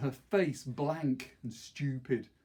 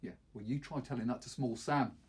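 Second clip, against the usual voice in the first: ordinary delivery, nothing marked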